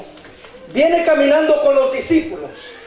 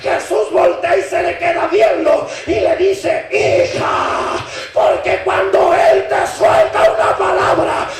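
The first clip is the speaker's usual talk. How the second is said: prédica a viva voz; 6 semitones higher than usual. Real, not acted. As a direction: preach it with full fervor. (predicación encendida)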